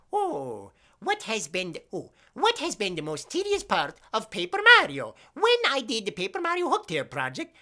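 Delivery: high, wobbling put-on voice; strained put-on voice. Streamer answering a question about Paper Mario, silly high voice. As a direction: push the voice up high until it strains. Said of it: "I can't do this, my voice is going".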